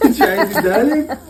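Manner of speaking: amused laughing question. Real, not acted. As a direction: chuckle while asking. Laughing at baby's refusal.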